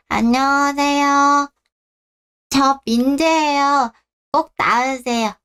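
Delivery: put-on high, slow little child's voice; a shy toddler greeting grandpa; small child. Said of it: "민재야". (할아버지에게 인사하는 어린아이 목소리)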